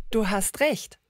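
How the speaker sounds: ordinary talk, nothing marked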